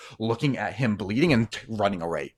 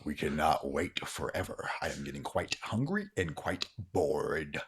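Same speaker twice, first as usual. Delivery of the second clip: lower, much softer impatient alien; bored alien entity; 5 semitones lower, 13 dB quieter than usual. Acted. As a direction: grumble it quietly, bored and hungry. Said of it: "And he's like".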